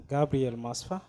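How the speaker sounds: ordinary delivery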